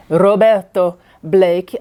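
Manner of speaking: Roberto Blake with a rolled Spanish R, slow and careful; Spanish-style name accent. Real, not acted. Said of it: "my accent on certain people's names".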